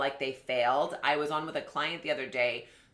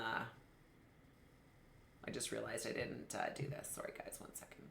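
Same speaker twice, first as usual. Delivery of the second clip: near-whispered aside off to the side; quiet aside; 6 semitones lower, 18 dB quieter, slower than usual. Real, not acted. Host murmurs to viewers while adjusting the camera, hushed. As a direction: drop to a breathy murmur while fixing something.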